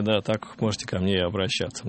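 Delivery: ordinary delivery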